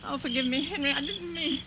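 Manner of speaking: soft trembling apology; shaken remorse. Acted. Aunt Julie begging dead Henry's forgiveness, shaken. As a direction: whisper it to the dead man.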